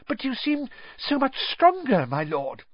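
high, fawning servant voice; high, wheedling servant; nervous servant. Acted. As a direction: flatter him nervously.

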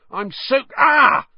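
sudden high yelp as the water hits; a shocked cry cut off mid-sentence; startled yelp. A narrator's reading. Ron yelps as a water bomb bursts on his head. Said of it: "Drenched and spluttering".

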